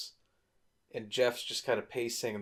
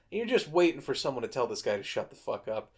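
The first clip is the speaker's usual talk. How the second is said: ordinary delivery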